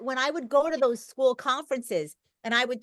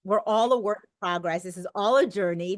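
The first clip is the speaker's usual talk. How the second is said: ordinary talk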